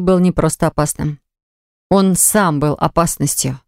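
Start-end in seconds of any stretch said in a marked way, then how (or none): none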